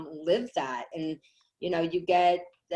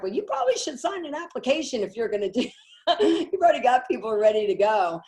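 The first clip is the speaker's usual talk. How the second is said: amused advice; 6 semitones higher, 4 dB louder than usual. Retold or imitated. Retelling Cheryl's amused advice.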